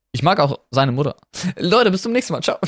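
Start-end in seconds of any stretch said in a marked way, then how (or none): 1.6-2.6 cheery high outro; sign-off. Acted. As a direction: wave the listeners goodbye. (fröhliche Verabschiedung)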